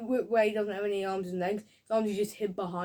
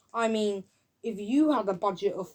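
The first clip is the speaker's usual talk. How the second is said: ordinary talk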